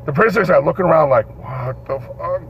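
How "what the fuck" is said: slow, near-whispered disbelief; imitated prisoners' hushed astonishment; stunned hush. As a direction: breathe it out slowly, looking around. Stunned prisoners looking around in story.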